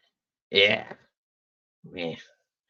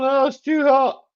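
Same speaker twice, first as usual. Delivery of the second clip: higher, whiny imitation of complainers; mock people moan; 11 semitones higher, 4 dB louder than usual. Mocking people who moan about the heat, whiny imitation. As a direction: whine it like everyone moaning about summer. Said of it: "that's a people moan".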